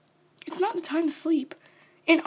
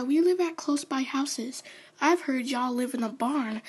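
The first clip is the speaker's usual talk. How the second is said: normal talking voice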